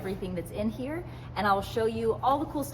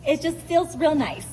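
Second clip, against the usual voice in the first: higher, louder, stressed real; emphatic; 7 semitones higher, 6 dB louder than usual. Shown as a demonstration. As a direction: lean into real nice. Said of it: "repeated softly".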